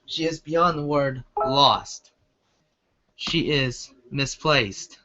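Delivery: slow dramatic emphasis. A narrator's reading. Narrator's dramatic realization.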